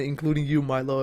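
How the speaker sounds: normal talking voice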